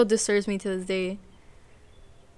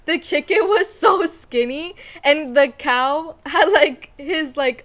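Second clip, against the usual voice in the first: higher and louder, slowed by laughter; laughing; 5 semitones higher, 5 dB louder, slower than usual. Real, not acted. Telling her friend about a cartoon, laughing. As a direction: giggle through the whole description. Laughing describing Cow and Chicken.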